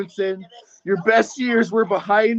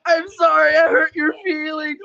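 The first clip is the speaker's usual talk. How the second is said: hammed-up fake sob, shouted and pitched up; mock crying; 6 semitones higher than usual. Taunting Godwinson over his hurt feelings, with a fake crying wail. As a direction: wail it like a crybaby, mock tears.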